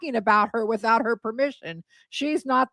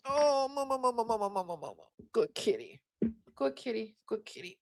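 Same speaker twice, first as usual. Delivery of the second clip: softer and softer cooing; soothing the cat; 10 dB quieter, faster than usual. Real, not acted. Owner hushing her cat with soft praise. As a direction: hush the cat with tender praise.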